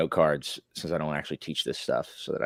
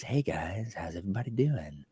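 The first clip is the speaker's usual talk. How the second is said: radio DJ voice; 4 dB quieter than usual. Shown as a demonstration. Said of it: "I can't be talking like this".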